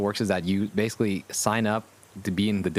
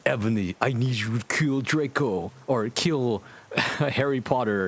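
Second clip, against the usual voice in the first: lightly voiced villain, slowing at the end; a hint of a menacing dark lord; lightly acted Voldemort, slow on the last words; 2 semitones higher than usual. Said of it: "Voldemort's like".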